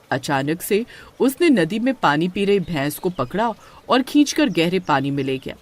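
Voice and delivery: ordinary delivery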